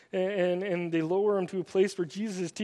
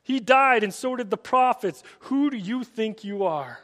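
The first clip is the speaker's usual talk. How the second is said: a lightly played, indignant challenge, louder than the narration; frustrated outrage; 5 semitones higher, 9 dB louder than usual. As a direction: throw the question back at him in mock outrage. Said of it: "get so frustrated and upset".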